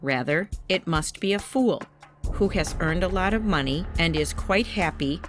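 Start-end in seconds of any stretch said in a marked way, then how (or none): none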